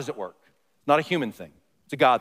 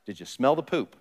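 ordinary talk, nothing marked

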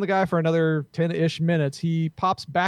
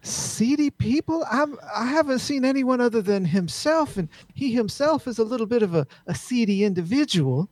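genteel Southern lady, pitched higher; upper-crust Southern accent; 7 semitones higher than usual. Acted. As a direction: gossip as high-voiced Southern gentry. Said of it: "all the upper crust all have that Southern accent".

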